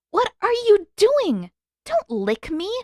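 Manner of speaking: high, loud, drawn-out scolding; outraged. A narrator's reading. Katara to Sokka after he licks her hand, outraged scold. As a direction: scold him for licking you.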